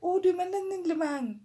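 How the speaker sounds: can't believe the price; shocked questioning; incredulous. Retold or imitated. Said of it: "I'm like".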